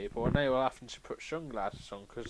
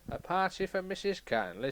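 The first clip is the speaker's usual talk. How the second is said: prim Fat Controller; 8 semitones higher than usual. Acted. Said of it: "I'm gonna read what they said I said".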